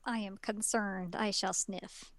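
low, quiet mock-solemn Inuyasha; deadpan the sniffing like grave business; hushed, deep parody of a serious hero. Friends voicing anime dog-boy.

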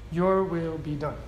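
low, soft surrender to God; softly spoken prayer of acceptance; quiet submission. Acted. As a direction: say it low and hushed, yielding. Preacher gives the faithful response softly.

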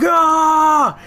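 high, loud drawn-out yell; battle scream. Retold or imitated. Resistance fighter running past with a rocket launcher, screaming. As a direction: imitate a charging fighter's scream. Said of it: "screaming. He's like".

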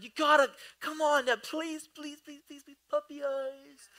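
high-pitched begging, softening as it repeats; pleading child. Retold or imitated. Son begging dad for cereal, mock pleading. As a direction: imitate a kid pleading with puppy eyes. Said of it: "He does puppy eyes".

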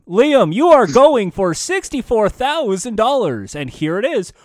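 booming mock host build-up; Wink Yahoo. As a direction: announce the stakes like a big quiz host. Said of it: "wanna see Wink Yahoo do Millionaire".

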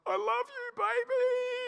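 a hushed, pleading goodbye through glass; imagined tender goodbye. Retold or imitated. Imagined prison goodbye to daughter.

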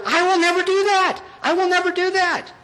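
high, emphatic mock vow; hammed-up protest of loyalty; Peter. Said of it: "Peter has to say to the Lord".

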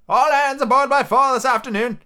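high, projected call; squire's shout. Acted. Squire to the departing cook, shouting orders after him. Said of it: "shouted the squire after him".

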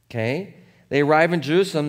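ordinary delivery

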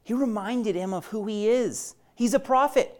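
ordinary talk, nothing marked

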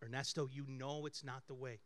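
quietly steady the angry man; a hushed low calm voice of God; voice of the Holy Spirit. Retold or imitated. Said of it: "I'm like".

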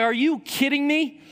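high-pitched exasperated disbelief; frustrated outburst. Retold or imitated. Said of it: "I was so frustrated".

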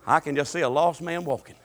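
ordinary talk

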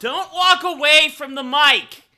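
loud, high, slow call after someone. Real, not acted. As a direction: shout after him as he leaves. Calls after friend leaving mic.

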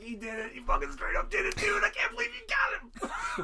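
high, amazed bro voice; excited dogs. Retold or imitated. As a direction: squeal in disbelief at a friend's dare. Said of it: "And they're like".

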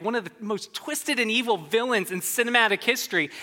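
ordinary talk

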